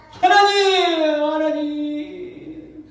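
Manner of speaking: high, loud, stretched wail of "하나님"; crying out to God. Retold or imitated. A father weeping in a car, wailing to God. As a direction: cry the name out long and high, weeping. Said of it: "눈물 흘리면서 펑펑 울면서".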